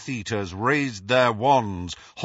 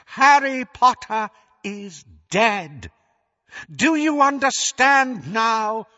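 Voldemort gloating; 10 semitones higher, 5 dB louder than usual. Acted. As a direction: gloat over the fallen hero to the crowd. Voldemort addresses the crowd.

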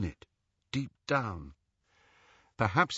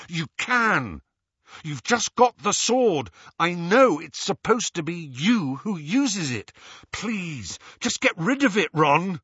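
raised, high insistent urging; urgent pleading; 7 semitones higher, 4 dB louder than usual. A narrator's reading. Harry urging Ron to destroy it, pleading hard. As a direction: push him with rising urgency.

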